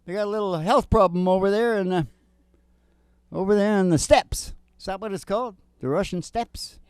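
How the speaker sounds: ordinary delivery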